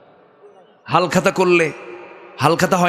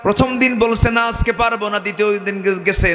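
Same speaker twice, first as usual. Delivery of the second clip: high-pitched sermon storytelling; preaching; 10 semitones higher than usual. Acted. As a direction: tell the parable in a high preacher's voice.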